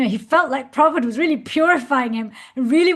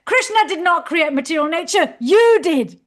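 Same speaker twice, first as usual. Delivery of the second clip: higher, louder stern teacher's reply; heavy rebuke; 6 semitones higher, 6 dB louder than usual. Retold or imitated. Teacher firmly correcting his questioning student, loud and stern. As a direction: land the verdict hard on you did. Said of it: "Prabhupada was so heavy".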